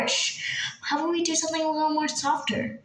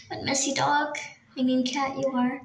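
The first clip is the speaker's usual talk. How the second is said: usual speaking voice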